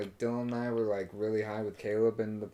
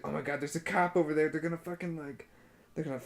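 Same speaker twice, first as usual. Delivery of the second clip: mock freak-out; 4 semitones higher, faster than usual. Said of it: "And so he would freak out".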